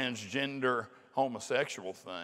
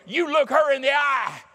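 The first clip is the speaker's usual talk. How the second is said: high, loud scolding teacher shout; teacher yells at the boy to look at the girl, a high scolding shout; 10 semitones higher, 7 dB louder than usual. Retold or imitated. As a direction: bark it at the boy like an angry teacher. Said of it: "And that teacher grabs him and goes".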